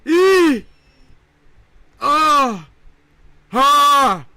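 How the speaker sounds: very high, very loud shrieking yells; all-out shriek to launch upward; full scream. Real, not acted. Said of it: "do I have to yell louder to get higher".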